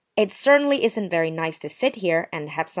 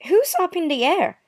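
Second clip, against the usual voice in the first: indignant; 10 semitones higher than usual. Acted. Indignant retort in quarrel.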